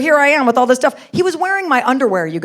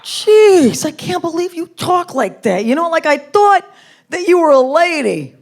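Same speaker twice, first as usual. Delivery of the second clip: higher-pitched, appalled New Yorker; scolding New York accent; 5 semitones higher than usual. Retold or imitated. Boyfriend lecturing girlfriend about swearing, scolding New York accent. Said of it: "jump on me for cursing".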